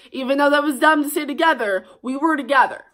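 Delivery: normal talking voice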